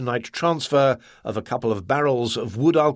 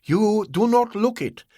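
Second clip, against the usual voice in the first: a higher, measured Ah Wong voice; slow, measured; 10 semitones higher than usual. Acted. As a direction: weigh each word slowly. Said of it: "Ah Wong said slowly".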